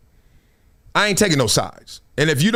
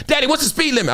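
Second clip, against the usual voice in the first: higher, lightly voiced little girl asking; daughter's voice; 8 semitones higher, faster than usual. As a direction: mimic the daughter checking on Daddy. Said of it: "my daughter always said".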